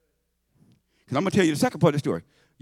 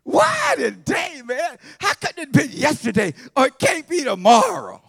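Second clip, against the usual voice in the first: higher, louder mock wail, drawn out at first; exasperated complaint; 8 semitones higher, 6 dB louder, slower than usual. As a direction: whine it like a spoiled kid denied dinner. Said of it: "your first thing out of your mind is".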